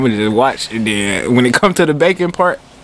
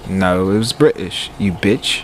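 low, slow attempt at a British accent; amateur British accent, drawn out; 7 semitones lower, 5 dB quieter, slower than usual. Shown as a demonstration. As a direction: answer back in a put-on British accent. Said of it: "your British accent".

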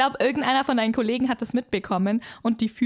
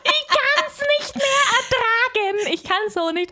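laughing; 12 semitones higher, 4 dB louder than usual. Acted. Mock outrage while laughing.

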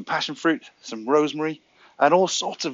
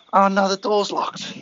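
mock dismay; 6 semitones higher, 4 dB louder, slower than usual. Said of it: "set up some tension and put some obstacles".

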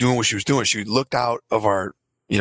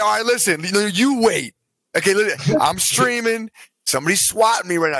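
higher-pitched, insistent retelling; urgent explaining; 7 semitones higher than usual. Retold or imitated. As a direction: plead your case to police below. Retold standoff with swat team.

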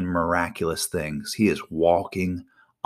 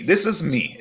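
normal talking voice

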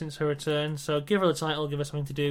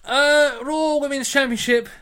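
announcer voice; 7 semitones higher, 5 dB louder, slower than usual. Acted. Announcing the match category.

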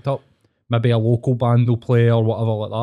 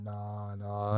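quiet; 3 semitones lower, 15 dB quieter than usual. Retold or imitated. Reluctant guy voiced in story.

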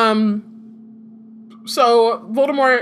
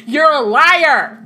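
higher, louder, very slow; slow loud accusation; 6 semitones higher, 7 dB louder, much slower than usual. Retold or imitated. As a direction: drag it out as an accusation. Mock crowd accusation.

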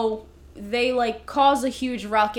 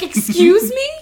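mock shock; 7 semitones higher, 11 dB louder than usual. Retold reaction, laughing.

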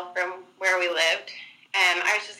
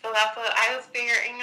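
ordinary talk, nothing marked

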